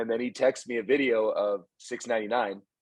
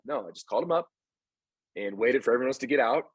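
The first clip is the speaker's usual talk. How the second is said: ordinary talk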